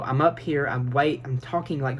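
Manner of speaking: usual speaking voice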